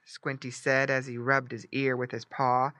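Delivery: ordinary delivery, nothing marked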